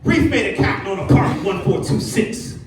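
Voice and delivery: mock complaint. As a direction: confront the leasing manager.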